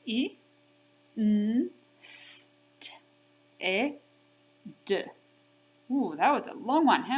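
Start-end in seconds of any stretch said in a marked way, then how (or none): 0.0-5.0 I, N, S, T, E, D as six phonemes, S and T voiceless; sounded out. Shown as a demonstration. Teacher counting sounds with kids, sounded out. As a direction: say I, N, S, T, E, D by sound, with gaps. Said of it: "Let's count the sounds".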